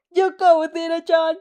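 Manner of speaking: loud cheering shout from the background. Acted. A boy in the background shouting at the TV, loud cheer. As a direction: yell it like a fan at the TV. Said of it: "a boy shouts in the background".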